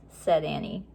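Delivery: plain reading voice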